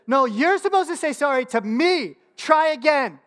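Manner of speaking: high, loud mock scolding; hammed-up indignation, rising to the end; indignant child. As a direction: imitate a bossy little sister correcting her brother. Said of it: "frozen in a state of indignation".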